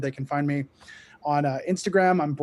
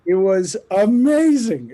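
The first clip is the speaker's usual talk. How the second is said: excited; 6 semitones higher, 11 dB louder, slower than usual. Real, not acted. Gushing about a surprise concert.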